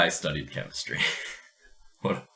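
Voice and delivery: usual speaking voice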